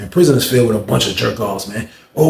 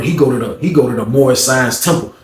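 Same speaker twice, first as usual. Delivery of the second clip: usual speaking voice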